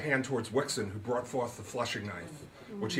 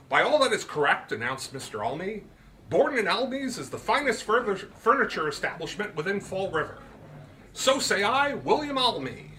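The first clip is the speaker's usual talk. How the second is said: higher, louder, pompous merchant; Almy's voice; 8 semitones higher, 4 dB louder than usual. A narrator's reading. Almy boasts of his furniture store, higher and proud. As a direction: declare it like a proud shopkeeper.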